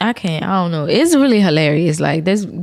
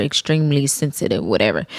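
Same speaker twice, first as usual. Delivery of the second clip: normal talking voice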